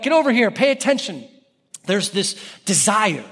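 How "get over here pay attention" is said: high-pitched summons; raised-pitch vendor's demand for attention; imitated calling out. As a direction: call someone over urgently. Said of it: "they're calling out".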